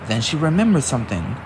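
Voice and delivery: ordinary delivery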